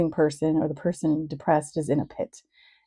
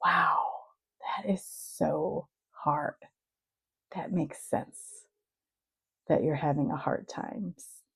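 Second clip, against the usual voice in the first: soft, slow, validating; sit with someone's pain and speak softly; hushed, unhurried sympathy with room between phrases; gentle empathy; 6 dB quieter, slower than usual. Acted. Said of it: "You're just like".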